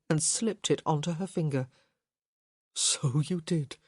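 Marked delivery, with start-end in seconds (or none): 2.7-3.8 tender reply. A narrator's reading. Gareth tender to Hyacinth.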